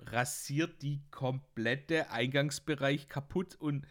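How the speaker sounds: normal talking voice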